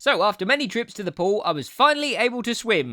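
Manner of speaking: ordinary delivery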